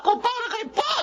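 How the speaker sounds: angry outburst. Acted. Accusing friend.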